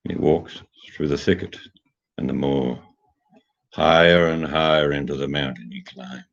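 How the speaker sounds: eerie tale told low and slow; spooky story narration. Acted. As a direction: tell it like a campfire tale, drawing them in. Tells a spooky bedtime-style tale.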